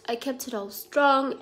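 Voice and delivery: normal talking voice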